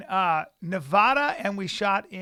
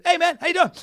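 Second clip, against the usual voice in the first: much higher and louder, wired and chummy; high guy greeting; 11 semitones higher, 10 dB louder, faster than usual. Retold or imitated. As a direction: play the coked-up actor saying hi. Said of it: "And he goes".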